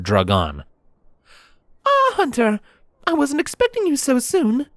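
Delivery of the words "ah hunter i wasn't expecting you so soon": very high-pitched mayor greeting a visitor; mayor's voice. Acted. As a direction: greet him in a much higher voice.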